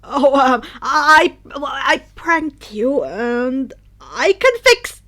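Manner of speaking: loud, halting, frightened excuse; nervous stammer. Acted. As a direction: stumble over the excuse in panic. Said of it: "Denki tried to explain".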